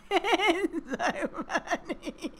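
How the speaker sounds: squeaky laugh through the words. Real, not acted. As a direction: giggle it out. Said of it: "laughing at jobby".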